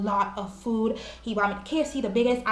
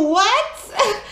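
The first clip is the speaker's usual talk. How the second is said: higher, louder disbelieving what; shocked what; 10 semitones higher, 5 dB louder than usual. Retold or imitated. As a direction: blurt it out in disbelief. He offers to buy phone.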